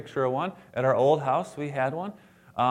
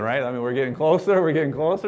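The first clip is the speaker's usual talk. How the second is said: ordinary talk, nothing marked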